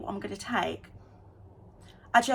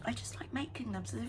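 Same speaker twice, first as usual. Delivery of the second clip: whispered cheeky aside; whispering; 8 dB quieter than usual. Real, not acted. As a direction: whisper it defiantly. Defiant aside.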